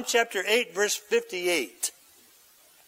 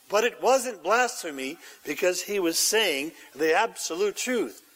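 normal talking voice

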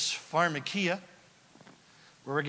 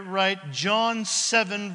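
ordinary talk

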